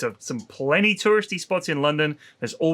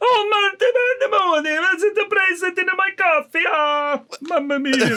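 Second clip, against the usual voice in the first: Italian coffee man; 13 semitones higher than usual. Pretend. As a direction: ham up an outraged Roman café guy. Coffee guy voiced, Italian flavour.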